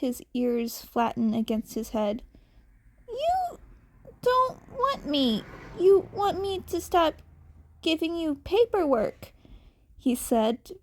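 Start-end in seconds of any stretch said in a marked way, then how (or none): 3.1-9.3 put-on high, small hurt boy voice; high bitter child's voice; bitter, hurt kid. A narrator's reading. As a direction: say it like a kitten expecting rejection. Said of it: "the bitter words falling".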